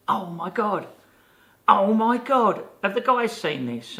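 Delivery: high, loud gasping oh my God; retelling her astonished outburst; excited shock. Retold or imitated. Woman spotting the tattoos, shrieking in shock.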